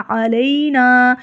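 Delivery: ordinary delivery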